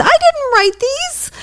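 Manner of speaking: high, slow, put-upon protest; high indignant denial. Real, not acted. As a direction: protest you never wrote it. Disowning the card.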